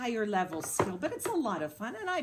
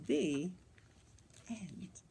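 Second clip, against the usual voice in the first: ordinary delivery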